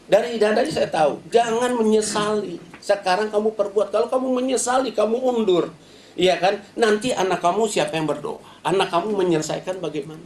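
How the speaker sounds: high, urgent preacher's exhortation; fiery preaching. Acted. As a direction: preach it at the congregation with fire.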